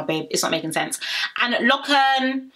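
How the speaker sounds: ordinary talk, nothing marked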